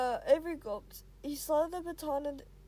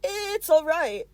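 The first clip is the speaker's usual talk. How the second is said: ordinary delivery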